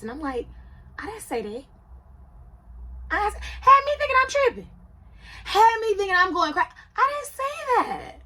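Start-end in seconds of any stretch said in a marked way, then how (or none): 1.0-1.6 softly protest, well under normal volume; acted soft, puzzled pushback; quiet denial. Wife answering husband's version of what she said, quietly denying. 3.1-8.2 acted protest, pitched way up high; exasperated squeal. Wife protesting that he had her doubting herself, high exasperated squeal.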